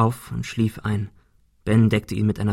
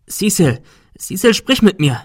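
high, loud urging to a fading friend; raised voice, pressing for an answer; urgent plea; 9 semitones higher, 6 dB louder than usual. Acted. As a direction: call out to keep them awake. Urging injured Cecil to respond.

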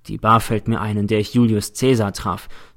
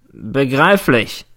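drawn-out, grinning reply; 2 semitones higher, much slower than usual. A narrator's reading. Prosecutor answering the nervous witness, grinning. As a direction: stretch it out with an audible grin. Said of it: "grinst der Staatsanwalt".

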